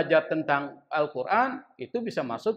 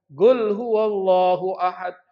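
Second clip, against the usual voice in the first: slow Hadrami-accented chant, GUL for qul; logat Hadramut; 4 dB louder, slower than usual. Shown as a demonstration. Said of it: "dengan logat Hadramut". (lantunan logat Hadramut, qaf jadi "Gul")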